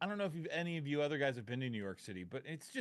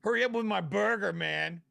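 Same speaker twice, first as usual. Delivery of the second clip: louder, higher, impatient demand; loud pushy customer; 6 semitones higher, 10 dB louder than usual. Retold or imitated. A pushy New Yorker yelling for his order, loud and impatient. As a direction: bark it at the counter.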